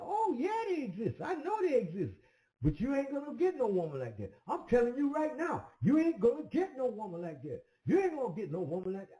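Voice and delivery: high, emphatic voice of the friend, driving it home; insistent friend. Retold or imitated. Friend telling him he'll never get that woman, high insistent. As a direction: keep hammering that he'll never get her.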